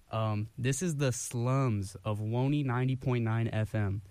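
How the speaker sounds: ordinary talk, nothing marked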